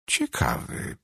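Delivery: soft, half-voiced relief; understated, quiet sigh of relief; relieved. A narrator's reading. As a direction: let it out on a relieved breath. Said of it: "odetchnąłem z ulgą".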